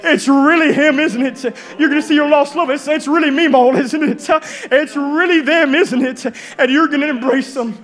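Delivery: impassioned sermon climax, building in waves; fervent preaching. Acted. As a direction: preach it with growing wonder to the congregation.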